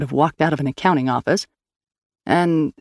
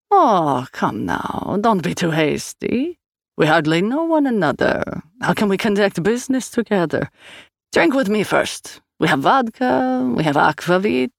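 higher Swedish sing-song, hammed-up hurt feelings; mock offended Swede; 5 semitones higher than usual. A narrator's reading. Swede pretending offense and offering vodka, Scandinavian sing-song. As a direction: Scandinavian lilt, wheedling them to drink. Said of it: "pretense of being offended".

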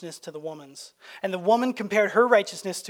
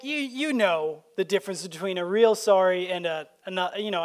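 normal talking voice

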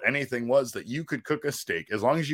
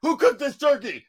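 excited question. Retold or imitated. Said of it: "I was just like".

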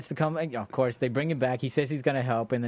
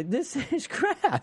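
hoher, langsamer, lachender Spott; blunt verdict, laughing; 12 semitones higher, much slower than usual. Retold or imitated. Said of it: "rather than say".

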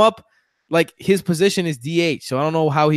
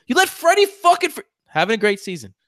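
much higher, heated outrage at the fans; emotional rant; 9 semitones higher than usual. Real, not acted. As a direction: plead with Mets fans, fired up. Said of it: "Are you done being all emotional?".

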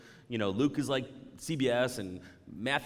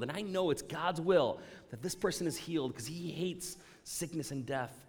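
normal talking voice

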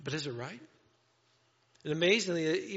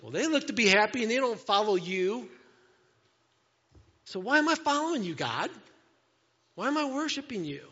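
higher, louder, hurt protest at God; indignant complaint; 5 semitones higher, 7 dB louder than usual. Retold or imitated. Believer asks God why he follows him, indignant and louder. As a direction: demand why, voice rising. Believer comparing self to others.

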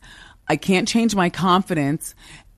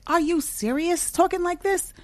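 put-on high, small girlish voice; mock a cutesy woman, pitched way up; 12 semitones higher, 3 dB quieter than usual. Mocking women who use a baby-like voice, high and cutesy. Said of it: "stop that cutesy thing".

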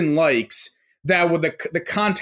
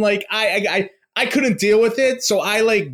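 ordinary talk, nothing marked

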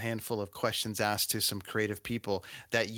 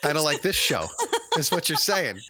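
laughing; 5 semitones higher, 7 dB louder than usual. Real, not acted. Teasing joke about own show.

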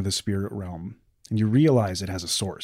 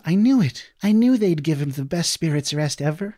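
put-on high, eager little boy; small boy voice; 8 semitones higher, 5 dB louder than usual. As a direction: squeak it out like an excited child. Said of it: "and says".

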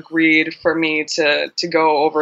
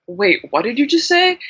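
confused friend; 7 semitones higher than usual. Retold or imitated. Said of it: "they'll be like".

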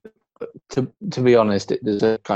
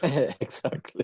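laughing; 4 semitones higher, 7 dB quieter, slower than usual. Real, not acted. Agreeing after a joke.

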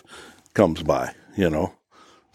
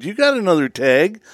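much higher, lightly voiced wife; imitating wife; 9 semitones higher than usual. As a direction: squeak out the wife's puzzled question. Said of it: "she said".

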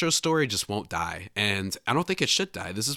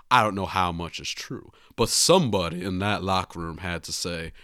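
a rough amateur take on a genteel Southern sleuth; Benoit Blanc voice; 3 semitones lower, 8 dB louder than usual. Retold or imitated. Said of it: "read it in my best Benoit Blanc voice".

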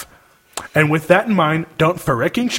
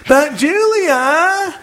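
high, drawn-out whine of a name; whiny little voice; 12 semitones higher, much slower than usual. Retold or imitated. Mocking a whiny sister reading the email, whining. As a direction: whine it like a spoiled sorority girl. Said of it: "in your whiny little bitch voice".

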